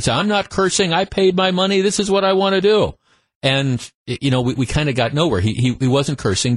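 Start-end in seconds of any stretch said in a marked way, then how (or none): 0.1-2.9 higher, indignant mock protest; a hint of a defiant heckler; belligerent fan. Host imitating the belligerent fan defending himself. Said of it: "the guy got kind of belligerent".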